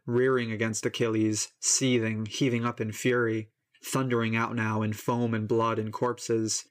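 ordinary delivery, nothing marked

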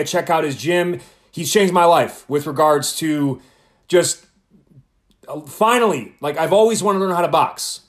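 loud, punchy announcer delivery; podcast intro read. Acted. Host introducing his boxing coach to listeners, upbeat announcer read. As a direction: sell the guest to the listeners.

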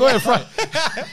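hammed-up Italian gangster telling someone what to cook; Italian gangster accent. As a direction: put on a pushy Italian mobster accent. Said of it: "a Italian gangster accent".

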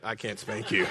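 laughing. Real, not acted.

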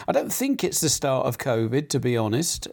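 usual speaking voice

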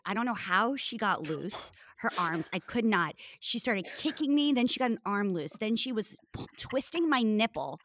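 ordinary talk

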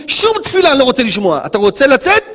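God pushing Moses to act. Acted. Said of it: "אומר הקדוש ברוך הוא למשה רבנו".

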